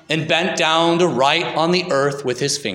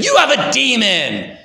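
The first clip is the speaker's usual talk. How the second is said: higher, sharp angry accusation; angry retort; 8 semitones higher, 4 dB louder than usual. Acted. Authorities lashing out at Jesus, angry and high. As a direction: spit it out, lost for words in anger. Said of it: "in their anger".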